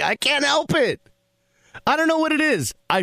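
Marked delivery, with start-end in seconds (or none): none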